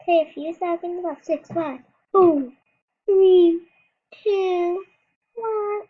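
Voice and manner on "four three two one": rising shouted countdown; loud countdown. Real, not acted. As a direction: call out the count. Counting down, louder each number.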